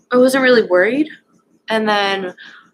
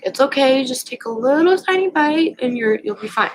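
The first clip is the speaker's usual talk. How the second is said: higher, gentle coaxing friend voice; 6 semitones higher than usual. Retold or imitated. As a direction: sweetly coax her to take one bite. Friend coaxing her to eat.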